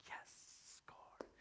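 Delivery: whispered private victory; hushed, secret cheer; gleeful whisper. Acted. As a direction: celebrate under your breath. Secret delight at being tagged in.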